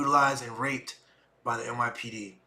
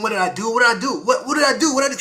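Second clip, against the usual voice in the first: desperate question; 8 semitones higher, 8 dB louder, much faster than usual. Retold or imitated. Pleading while arrested, retold.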